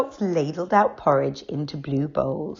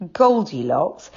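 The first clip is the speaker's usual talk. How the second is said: ordinary delivery, nothing marked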